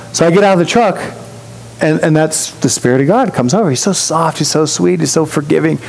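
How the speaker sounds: ordinary talk, nothing marked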